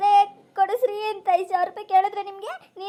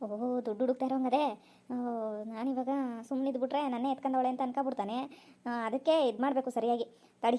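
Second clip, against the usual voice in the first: lower, softer sly aside; scheming aside; 5 semitones lower, 6 dB quieter than usual. Acted. Wife scheming aside about the hidden money, softer. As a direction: plot under your breath.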